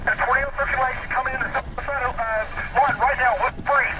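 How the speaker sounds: fast, high, urgent radio call; urgent excited report. Real, not acted. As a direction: report the tornado breathlessly. Live tornado report on radio.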